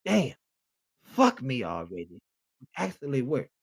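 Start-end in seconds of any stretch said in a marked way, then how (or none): 0.0-2.2 "fuck me already" laag en traag, spottend nagedaan; slow low sleazy parody; mock seductive line. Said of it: "the opening message being".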